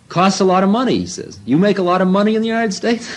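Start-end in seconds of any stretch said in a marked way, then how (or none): 0.1-1.0 much higher, louder teasing guru; a sly old man baiting him; guru's voice. Retold or imitated. Guru teasing about money. 1.5-3.1 much higher, laughing teasing guru; guru's voice. Retold or imitated. Guru teasing about money.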